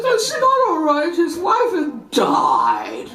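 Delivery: put-on high slow protesting little girl; mock indignant child. Girl protesting her mother's dismissal, mock indignant. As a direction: insist it's not all right. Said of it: "child protesting to mother".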